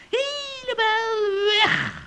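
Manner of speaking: slow, stretched gloating; drawn-out gloat. Acted. Witch tells friends Joris is gone, slow drawn-out gloat. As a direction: draw the words out triumphantly.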